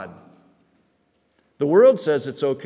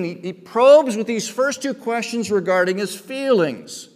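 usual speaking voice

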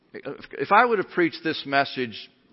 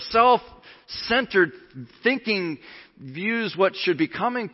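high, loud, driving sermon delivery; heated pulpit urgency, voice raised; impassioned preaching; 8 semitones higher, 5 dB louder than usual. Real, not acted. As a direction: press the warning on the congregation. Preaches at full force.

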